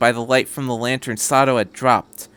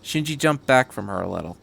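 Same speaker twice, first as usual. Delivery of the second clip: ordinary delivery, nothing marked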